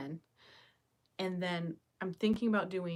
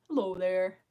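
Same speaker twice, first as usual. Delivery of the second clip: dinosaur mask voice; 5 dB louder than usual. Retold or imitated. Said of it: "Do your dinosaur mask impression".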